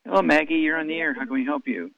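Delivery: normal talking voice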